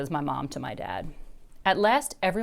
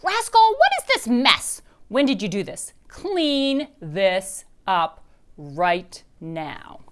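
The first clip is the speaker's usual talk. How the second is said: loud scolding mom, slowing down; angry mom; 3 semitones higher, 7 dB louder, much slower than usual. A narrator's reading. Mom scolds Dory about her giant fort, loud. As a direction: scold the child for the mess. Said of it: "Then I hear screaming".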